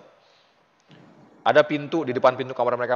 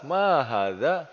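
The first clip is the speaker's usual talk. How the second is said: slow quiz question; 3 semitones higher, much slower than usual. Shown as a demonstration. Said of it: "teacher quizzing student".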